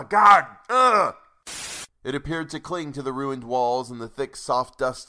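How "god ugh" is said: loud high groan of disgust; frustrated shout. Real, not acted. Fed-up reader yelling at the author, loud groan. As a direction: let out an exasperated yell. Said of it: "screaming about semicolons".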